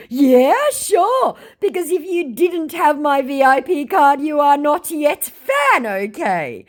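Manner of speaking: high, slow put-on scammer voice, pushy and a touch smug; reading a scammer's text to viewers, in a mock high scammer voice. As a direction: play the scammer's pitch, lightly hammed up. Scammer's reply acted out.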